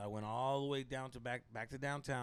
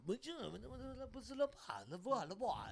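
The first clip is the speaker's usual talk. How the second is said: put-on a homeless man's slow, thick French accent, a bit higher; 6 semitones higher, 5 dB quieter than usual. Homeless man greeting a stranger in Quebec, mock French accent. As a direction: mimic a street guy's mock French greeting. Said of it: "but they had this French accent".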